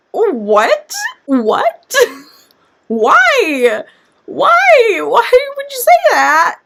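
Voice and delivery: high, laughing disbelief; hammed-up incredulity climbing in pitch; baffled outcry. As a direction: squeal the question in mock dismay. Acting out her baffled reaction.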